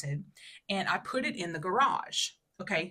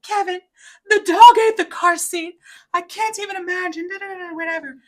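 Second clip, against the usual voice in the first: high, loud, distraught crying to her husband; upset wail; 12 semitones higher, 14 dB louder than usual. Retold or imitated. Phoning husband about the ruined car seat, high upset wail. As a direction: wail it out like you're sobbing. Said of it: "I called him sobbing at church".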